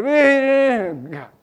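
high, loud imitated alarm beeping; alarm beeps. Preacher mimicking his snoozed alarm, high beeping. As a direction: beep like a phone alarm. Alarm again ten minutes later.

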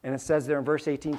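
normal talking voice

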